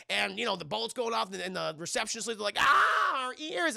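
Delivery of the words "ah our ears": high, loud yelp easing off; comic shriek of pain; pained mock scream. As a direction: imitate an operator deafened by noise. Receptionists hear gunfire over line.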